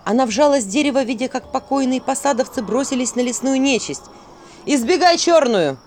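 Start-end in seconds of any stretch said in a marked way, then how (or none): 4.7-5.8 urgent warning shout. A narrator's reading. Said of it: "послышался голос".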